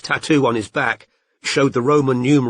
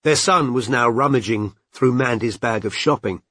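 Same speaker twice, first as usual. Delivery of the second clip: plain reading voice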